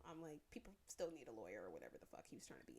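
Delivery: normal talking voice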